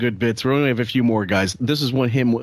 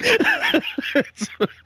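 very high burst of real laughter; helpless laughter. Real, not acted. Streamer enjoying two comics telling a mob story, helpless laughter.

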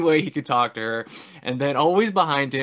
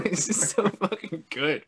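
usual speaking voice

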